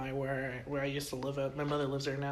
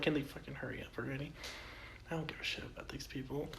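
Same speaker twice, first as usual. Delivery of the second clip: inner grumble; 11 dB quieter than usual. Retold or imitated. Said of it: "I was like".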